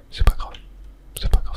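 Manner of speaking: ordinary delivery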